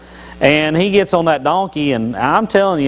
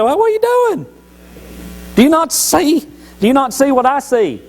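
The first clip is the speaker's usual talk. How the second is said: high, far-off put-on donkey voice, pleading; talking donkey voice; 11 semitones higher than usual. Donkey scolding Balaam for not seeing, in a high put-on voice. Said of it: "the donkey turns around and says".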